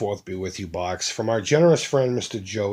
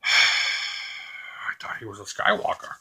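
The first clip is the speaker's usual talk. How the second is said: slow, sighing whisper of disappointment; weary whisper; 4 semitones lower, 5 dB quieter, much slower than usual. Retold or imitated. Disappointed master whispering after a sigh. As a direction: breathe it out slowly, let down. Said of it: "He's just like".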